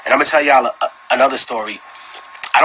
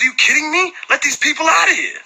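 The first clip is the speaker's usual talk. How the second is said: shouting boss. Retold or imitated. Old security boss yelling at his own guard. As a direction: yell it like the boss who's had enough. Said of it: "He said".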